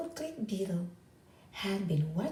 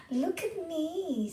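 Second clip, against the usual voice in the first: high, slow look at me; young beetle showing off, high and slow; eager show-off; 9 semitones higher, slower than usual. A narrator's reading. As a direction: call out proudly to be watched.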